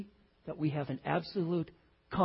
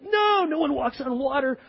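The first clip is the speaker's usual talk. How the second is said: Peter's doubt; 9 semitones higher, 5 dB louder than usual. Acted.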